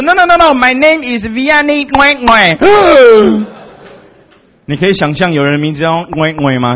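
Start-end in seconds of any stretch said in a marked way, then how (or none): 0.0-2.6 高声慢慢地说"Ngwe Ngwe"，学非洲同工的口气; 模仿他认真纠正名字; imitating Vianney. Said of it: "他说". 2.6-3.9 夸张的大声倒抽一口气; 故作震惊地大声吸气; loud mock gasp. Shocked at the name.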